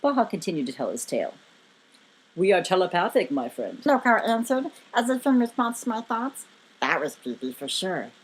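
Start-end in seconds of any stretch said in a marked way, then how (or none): 2.3-3.8 low, authoritative giant. A narrator's reading. Giant answering his guest's thoughts, low and authoritative. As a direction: state it with deep, calm authority. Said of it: "very authoritative tone".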